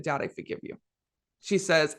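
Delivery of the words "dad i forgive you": imitating a breathless, tearful near-whisper; tearful hush. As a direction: say it softly, as if just broken down in tears. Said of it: "I broke down in tears and I said".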